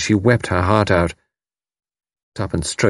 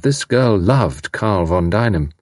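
plain reading voice

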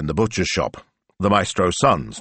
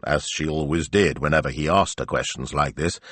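ordinary delivery

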